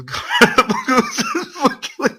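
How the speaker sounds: high, loud burst of laughter; loud laughing fit. Real, not acted. Reader howls with laughter at the fanfic plot. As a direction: howl with laughter.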